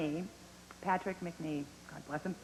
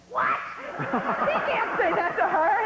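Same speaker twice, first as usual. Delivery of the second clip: high, slow, laughing shocked outcry; mock outrage; 11 semitones higher, 5 dB louder, slower than usual. Network censors objecting to the line, mock outraged. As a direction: ham up the network's horror. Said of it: "A B C went".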